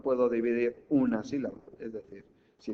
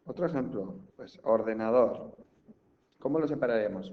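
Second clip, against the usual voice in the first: usual speaking voice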